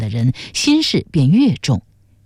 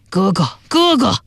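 acted anguished call, leaping much higher on the repeat; rough grief-stricken cry; 6 semitones higher than usual. A narrator's reading. Younger brother finds his brother dead, rough anguished cry. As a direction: cry out in grief, building sharply in pitch. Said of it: "突然传来一阵粗粝的悲声".

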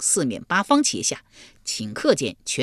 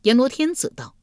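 ordinary delivery, nothing marked

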